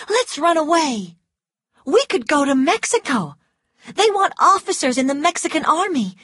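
put-on high, eager young woman begging; Scarlet pleading. A narrator's reading. Scarlet begging Ashley to run away, high and pleading. Narrator voices Scarlet at length.

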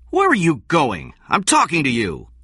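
high, loud, indignant challenge; angry demand. A narrator's reading. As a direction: call after someone walking out on you. Angry demand as King walks out.

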